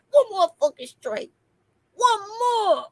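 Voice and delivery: high, teasing echo of the warning; lightly mocking repeat. Echoing the platform's threat in a high mock voice. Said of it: "they was like".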